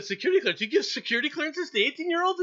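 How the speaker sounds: high, loud disbelieving question; incredulous. Retold or imitated. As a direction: balk at it in disbelief. Retold disbelief.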